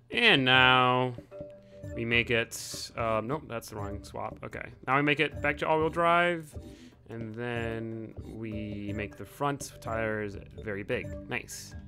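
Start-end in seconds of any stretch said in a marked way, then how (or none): none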